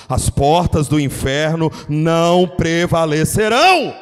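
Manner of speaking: slow, loud declaration of the verse; proclaimed scripture. Acted. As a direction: thunder the promise slowly. Quoting Jesus over music. (declaração lenta e bradada)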